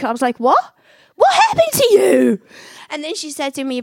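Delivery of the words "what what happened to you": much higher and louder, incredulous cry; stunned disbelief. Retold or imitated. Asking her changed friend what happened, stunned. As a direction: gasp it out in total shock.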